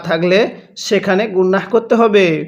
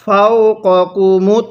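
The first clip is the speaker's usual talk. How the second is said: slow, drawn-out tajweed chant; Quran recitation; much slower than usual. Shown as a demonstration. Teacher demonstrates Quran reading to students, slow chant. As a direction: chant the verse slowly, stretching the vowels. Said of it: "কিভাবে পড়ব?".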